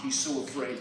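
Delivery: usual speaking voice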